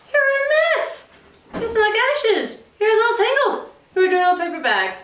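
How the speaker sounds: high, haughty put-on voice; snooty prince. Prince sneering at the messy princess, high. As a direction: look down your nose at her.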